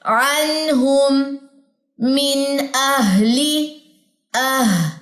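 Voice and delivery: very slow, drawn-out अनहुम, मिन अहली; measured recitation with a long breathy H in अह; recitation demo. Shown as a demonstration. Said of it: "अब examples देखिए".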